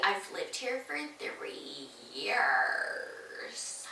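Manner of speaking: slow, smug, half-whispered put-down; snotty neighbor voice. Retold or imitated. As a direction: play her as condescending and unbothered. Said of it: "That's what I think she sounds like".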